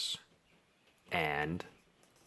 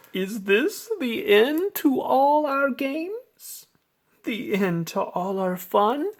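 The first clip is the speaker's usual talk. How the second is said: high character voice; 13 semitones higher than usual. Acted. The cats wonder if the games are over, high anxious voice. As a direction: ask it with wide-eyed worry. The queen or cats ask worried.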